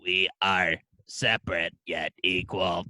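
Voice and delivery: deep booming alien decree; alien Splurn. Pretend. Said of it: "his name's Splurn".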